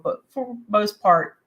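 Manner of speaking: usual speaking voice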